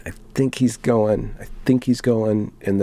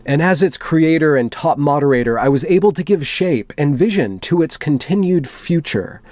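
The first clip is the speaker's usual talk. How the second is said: dramatic reading; 4 semitones higher than usual. Acted. Reading a moderator's manifesto to viewers, solemn and dramatic. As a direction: read it like a grave confession.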